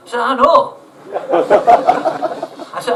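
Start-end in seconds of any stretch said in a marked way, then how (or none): 0.2-0.8 louder and higher, chuffed; loud amused reply. Retold or imitated. Shown his photo by the garage man, loud proud reply. 0.8-2.7 a long, high, unvoiced cackle of delight; high loud laugh. Real, not acted. Recognised from the internet, high loud laughing.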